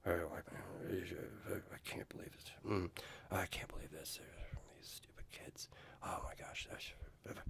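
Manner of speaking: very low, barely voiced grumbling to oneself; disapproving mutter. Gym stranger grumbles about the kids in the sauna, muttered disapproval. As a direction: mimic someone muttering disapproval under their breath.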